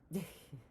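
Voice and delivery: brief guilty chuckle; suppressed laugh. Real, not acted. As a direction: let a small laugh slip out. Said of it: "I shouldn't laugh".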